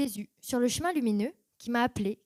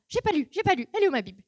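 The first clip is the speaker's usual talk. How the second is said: frantic urgency; 6 semitones higher, 7 dB louder, faster than usual. Retold or imitated. Frantic to read Bible.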